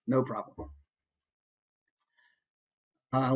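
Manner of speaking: normal talking voice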